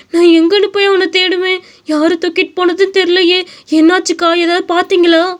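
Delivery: high, urgent pleading questions; frantic mother. Acted. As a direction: beg for any clue, panicked.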